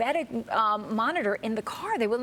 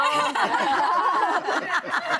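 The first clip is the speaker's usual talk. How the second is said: a high, loud laugh breaking out; real laughter spilling over; high burst of laughter; 7 semitones higher, 4 dB louder than usual. Real, not acted. Bursts out laughing mid story.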